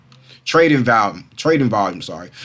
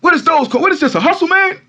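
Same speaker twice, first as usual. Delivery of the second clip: much higher, sneering imitation of the TV host; mocking news anchor; 4 dB louder, faster than usual. Mocking the TV anchor who called his coin a hustle, high sneering voice. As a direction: ham up the anchor's scoff, pitched way up. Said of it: "as a news broadcaster".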